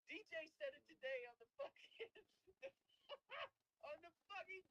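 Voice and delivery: high voice breaking into real laughter; cracking up. Real, not acted. Podcaster recalling a friend's joke from today, cracking up. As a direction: try to talk while cracking up.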